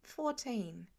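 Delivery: put-on a sheepish boy admitting his age; muttered quietly. A narrator's reading. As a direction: mumble it to the ground. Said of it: "he mutters quietly".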